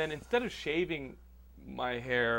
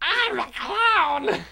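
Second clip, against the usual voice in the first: very high, slow, loud clown squeal; silly clown; 11 dB louder, much slower than usual. Pretend. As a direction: shriek it like a goofy clown. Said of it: "John Leguizamo mythos".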